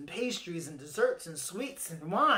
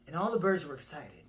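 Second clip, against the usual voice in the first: plain reading voice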